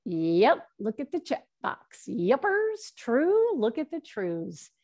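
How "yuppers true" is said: high, bright burst of yes; delighted yes. Real, not acted. Teacher cheering the class's chat answers, high and delighted.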